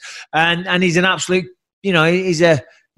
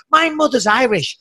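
lightly raised hint of an Irish mother; mock Irish accent; 7 semitones higher than usual. Joking to the host about his mum, light Irish accent. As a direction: slip into a touch of Irish accent, higher. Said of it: "My fucking mother talks like this".

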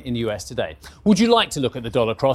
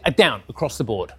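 ordinary delivery

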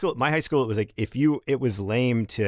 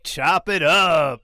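drawn out; 5 semitones higher, 4 dB louder, much slower than usual. Real, not acted. Calling for sound effect.

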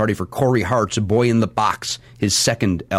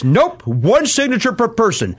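slow, raised mock refusal; curt star refusal; 11 semitones higher, slower than usual. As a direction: spell out the rule with a mock stiff air. Retold star refusing again.

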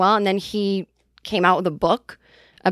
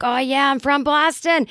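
put-on higher, drawn-out Boston accent; 6 semitones higher than usual. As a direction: imitate a proud Boston local. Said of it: "talking in our Boston accents".

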